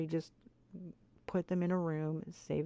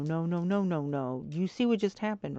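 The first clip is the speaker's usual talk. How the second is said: usual speaking voice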